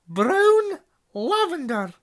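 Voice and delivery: high, loud stern roll call; calling a name. A narrator's reading. McGonagall calls the next student to the stool. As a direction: call the name out across the hall.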